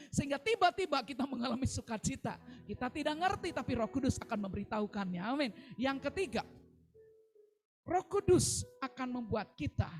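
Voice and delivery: usual speaking voice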